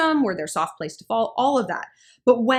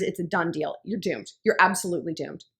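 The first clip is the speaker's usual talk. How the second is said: ordinary talk, nothing marked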